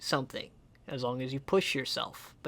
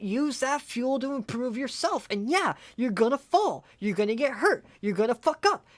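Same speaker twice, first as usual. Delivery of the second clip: higher, pushing hard with real fire; raised, impassioned motivation; passionate pep talk; 8 semitones higher than usual. Real, not acted. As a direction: fire them up not to quit. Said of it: "I'm passionate about that kind of shit".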